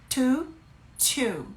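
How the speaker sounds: Two with a stronger puff, then two lighter. Shown as a demonstration. Teacher contrasting to and two for a viewer. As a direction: bigger breath on the first Two. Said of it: "Faz um soprinho maior e two".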